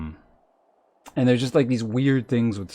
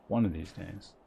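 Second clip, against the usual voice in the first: ordinary talk, nothing marked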